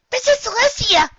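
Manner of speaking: high, loud startled exclamation; a young filly yelps her teacher's name in surprise; startled filly cry. Acted. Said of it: "Twilight Sparkle said, tilting her chair".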